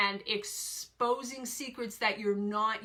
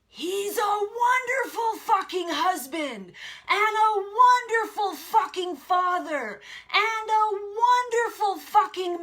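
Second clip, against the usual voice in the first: high, very loud screamed outrage; full-force enraged screaming at someone; explosion of rage; 10 semitones higher, 10 dB louder, slower than usual. Retold or imitated. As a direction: scream it furiously, stretching the final words. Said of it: "in an explosion of rage".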